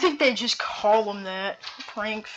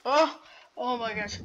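ordinary talk

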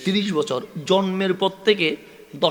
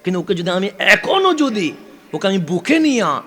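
rising sermon fervour; 2 semitones higher, 6 dB louder than usual. Acted. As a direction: swell the plea until it bursts out. Preacher swells at climax.

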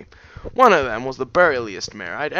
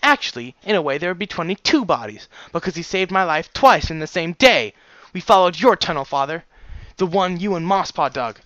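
raised, higher, indignant voice; pointed accusation; 6 semitones higher, 6 dB louder than usual. Acted. Daughter confronting father about his tunnel, indignant and pointed. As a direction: press the point home indignantly.

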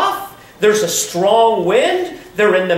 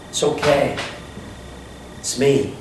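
soft, tender reassurance; calm a scared friend softly; gentle comfort; 6 semitones lower, 6 dB quieter than usual. Acted. Voicing Jesus comforting disciples.

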